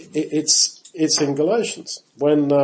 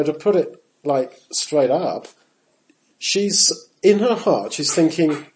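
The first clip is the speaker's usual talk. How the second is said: ordinary delivery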